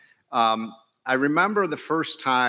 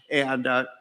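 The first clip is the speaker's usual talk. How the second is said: ordinary talk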